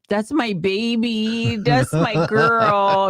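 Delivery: slow, high, loving coo; doting. Real, not acted. Doting on her dog.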